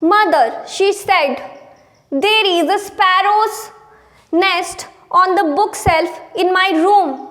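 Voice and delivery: deliberate English textbook reading; read-aloud lesson. Acted. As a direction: read it out phrase by phrase, slowly. Reads the English text aloud.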